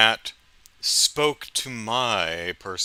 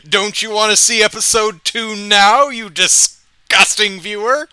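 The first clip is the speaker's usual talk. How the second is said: maniacal villain voice, higher and louder; mad scientist; 11 semitones higher, 9 dB louder than usual. Retold or imitated. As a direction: cackle it out like an evil showman. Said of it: "So the show ends and it's like".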